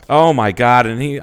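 usual speaking voice

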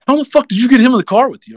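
high, fast astonished retelling; amazed outburst. Retold or imitated. Crew guy learning Chris Ray rides along, high astonished rush. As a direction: rattle it off high like he can't believe it.

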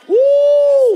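loud high preacher whoop; excited whoop. Real, not acted. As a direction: let out a joyful holler.

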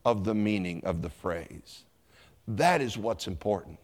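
ordinary delivery

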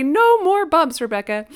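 teasing mom's singsong call; mom's playful catchphrase. Retold or imitated. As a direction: sing the phrase up high, then drop for the name. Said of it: "when she'd say".

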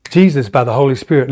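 ordinary delivery

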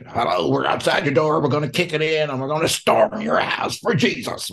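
lightly mocking the ones who kick the door in; pushy crusader. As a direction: ham up the zealot barging in. Mock pushy believers storming house.

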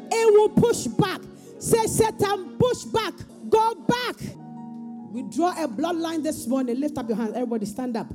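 high, slow fervent preaching; booming motivational speech. Real, not acted. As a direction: drive each declaration home. Leads congregation in declarations.

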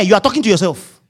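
normal talking voice

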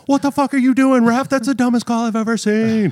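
rowdy fan yelling from the stands; heckling fan shout. Pretend. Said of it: "make him shout from the stands".

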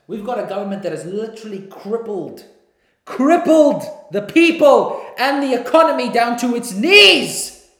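building to a full shout like a fired-up politician; rising from firm to a full shouted climax; mock political scream. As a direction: yell it from a campaign podium. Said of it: "I'm screaming because I'm trying to see if I could be".

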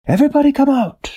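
a soldier's high shout to the town. A narrator's reading. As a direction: call the whole town out of hiding. Soldier calls townspeople out.

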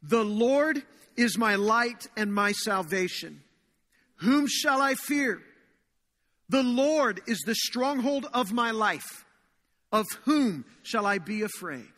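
slow, solemn congregational reading; measured psalm recitation; reading scripture aloud. Acted. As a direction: read each line out slowly, declaring it. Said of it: "let's read it together out loud".

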